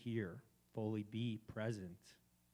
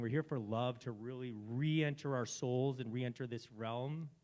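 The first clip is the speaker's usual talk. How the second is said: usual speaking voice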